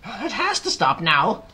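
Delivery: slow, high, loud insistence; Aunt Spiker yelling. A narrator's reading. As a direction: yell it out in exasperation. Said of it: "Aunt Spiker yelled".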